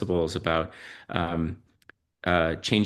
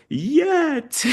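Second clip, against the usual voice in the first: imitating a little girl's much higher, grudging "Yet."; child's grudging yet; 5 dB louder than usual. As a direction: squeak out "Yet." like a sulky child admitting it. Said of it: "and she goes".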